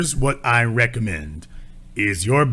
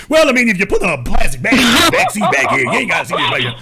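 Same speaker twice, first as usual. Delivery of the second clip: hammed-up salesman pitch, fast and high; mock a dodgy salesman's hurried, loud sales patter; shady used car salesman; 5 semitones higher, 4 dB louder, much faster than usual. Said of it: "That's what you sound like".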